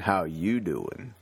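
slow, drawn-out New Jersey greeting; New Jersey accent. Shown as a demonstration. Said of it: "that New Jersey accent".

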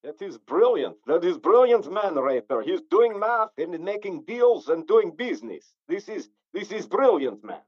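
mock Slavic admiration; Eastern European accent. Friend admiring the wife trade, in mock Eastern European accent. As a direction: praise him in a put-on Eastern European accent. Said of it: "I do a mean Eastern European accent".

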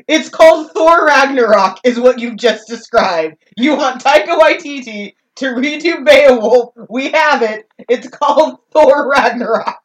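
loud, high, incredulous correction; loud insistence. Real, not acted. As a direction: hammer the point home to your co-host. Exasperated correction of co-host, real outburst.

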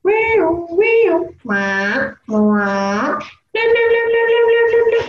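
sing-song siren rotation; imitated car alarm. Imitating Chilean car alarms for a friend, high siren sounds. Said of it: "But it's like".

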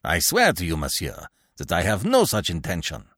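ordinary delivery, nothing marked

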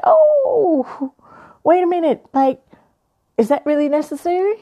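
high, put-on indignant "oh, wait a minute"; mock high indignant voice. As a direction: act out a high, offended reply. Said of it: "And I was like".

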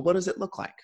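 ordinary talk, nothing marked

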